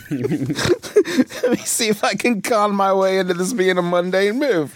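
high, slow words through a laugh. Real, not acted. Player conning the game master into a move, laughing. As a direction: laugh into it, then stretch the plea out.

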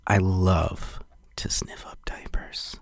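slow, very soft sleazy whisper. Shown as a demonstration. Host overdoing a diaper endorsement, sleazy whisper. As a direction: whisper it slowly, like a creep savoring it. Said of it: "Not sleazy, though".

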